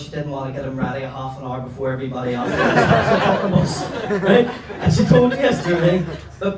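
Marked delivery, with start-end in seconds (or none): 2.6-4.2 bursts out laughing; big laugh at his own story. Real, not acted. Laughing at the punchline of his own joke.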